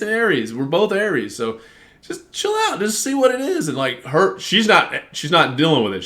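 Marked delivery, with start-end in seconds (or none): 2.1-3.7 high, soft soothing words; mild hint of a calming voice; retold calming plea. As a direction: talk her down gently. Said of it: "I even told her".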